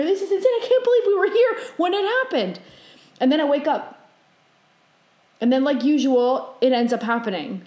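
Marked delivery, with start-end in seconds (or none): 0.0-2.6 high, excited retold disbelief; amazed. Dreamer amazed to witness it, high and excited. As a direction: exclaim it as if astounded, pitched up.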